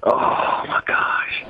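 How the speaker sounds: breathy unvoiced gasp of shock; shocked whisper. Real, not acted. Cohost reacting to diver hooked between legs, shocked whisper. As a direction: whisper it in disbelief.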